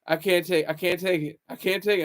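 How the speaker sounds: usual speaking voice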